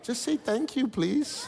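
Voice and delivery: exasperated plea. Retold or imitated. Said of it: "I'm like".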